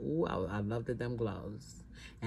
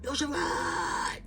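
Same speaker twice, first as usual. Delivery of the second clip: high, slow, drawn-out holler; stretched Bronx street holler; yelling out the window; 11 semitones higher, much slower than usual. Retold or imitated. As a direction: call her name down from the window. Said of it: "he yells out".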